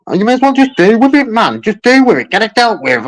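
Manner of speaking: real frustration boiling over at the agent; exasperated. Real, not acted. Customer fed up on complaint call.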